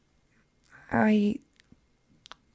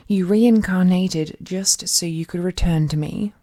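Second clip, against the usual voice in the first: ordinary talk, nothing marked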